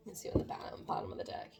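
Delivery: whispered under her breath, voiceless. Real, not acted. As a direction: murmur it to yourself as you peek at the deck. Reading cards half to herself.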